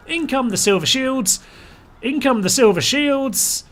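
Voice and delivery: high-pitched rallying announcement; excited battle call. Real, not acted. Hailing his troops' arrival in game.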